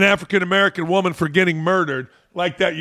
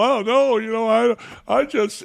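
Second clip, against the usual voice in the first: high, mocking simper; hammed-up awkward denial; mock fake-nice reply; 6 semitones higher than usual. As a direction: lampoon a nervous dodge, pitched up. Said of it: "we all had to bullshit and go".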